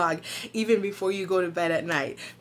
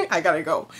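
laughing; 4 semitones lower, 5 dB louder, much faster than usual. Real, not acted. Laughs while signing off.

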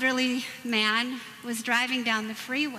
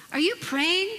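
mock parent; 5 semitones higher than usual. Parent asking again.

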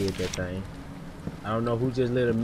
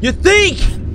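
high, loud incredulous retort; exasperated yell; 8 dB louder than usual. Real, not acted. Player answering "something's wrong here", yelling in disbelief. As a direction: fire it back at the obvious remark. Retort to game character's understatement.

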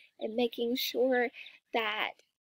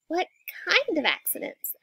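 put-on higher-pitched child's question; questioning little sister; 6 semitones higher than usual. A narrator's reading. Sister asking Mama about accidents, high curious voice. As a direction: ask it as a curious small girl.

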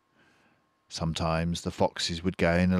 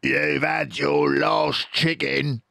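higher, slow gloating farmer; Boggis yelling; 8 semitones higher, 3 dB louder, slower than usual. A narrator's reading. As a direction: gloat down at the fox. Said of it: "yelled Boggis".

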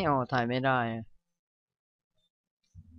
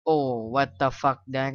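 normal talking voice

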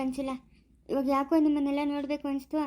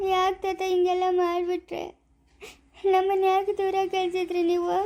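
crying, slow hurt question; tearful; 5 semitones higher, 3 dB louder than usual. Acted. Grandson asking grandfather why he was sent away, tearful. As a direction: plead for an answer through tears. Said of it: "ಯಾಕೆ ಇವಾಗ ನೀನು ಅಳ್ತಾ ಇರೋದು?".

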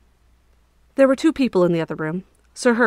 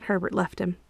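ordinary delivery, nothing marked

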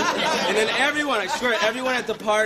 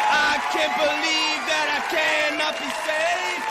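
shouting; 7 semitones higher than usual. Acted. Pauly Shore opening catchphrase shout.

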